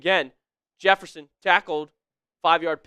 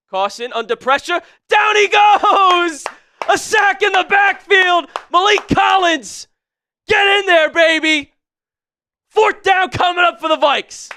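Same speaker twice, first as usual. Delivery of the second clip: high, loud, drawn-out sack call at full force; screaming play-by-play; 12 semitones higher, 9 dB louder, slower than usual. Real, not acted. Commentator yelling a sack to his stream, real joy. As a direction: yell it like a live sack call.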